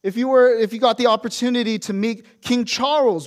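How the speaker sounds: usual speaking voice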